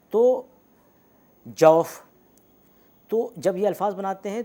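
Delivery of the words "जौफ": जौफ said loud with an open औ; au demo word. Shown as a demonstration. Teacher calls out an example word for the students. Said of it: "इस तरह के जो अल्फाज बनाते हैं".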